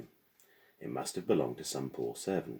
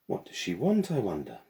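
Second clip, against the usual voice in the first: put-on high, rising worried girl's voice; anxious girl; 5 semitones higher, 5 dB louder than usual. A narrator's reading. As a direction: ask it with a knot of worry.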